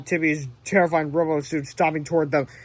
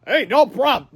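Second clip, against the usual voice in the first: trying to sound cool; 7 semitones higher, 6 dB louder than usual. A narrator's reading. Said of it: "trying to sound cool".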